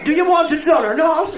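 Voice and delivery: loud and high, laughing through it; impatient shout. Acted. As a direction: bark it at him, out of patience.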